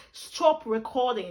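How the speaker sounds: slow, put-on British accent command. Shown as a demonstration. Telling the camera to stop, British accent. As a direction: stretch the words in a British accent. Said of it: "When I use British accent".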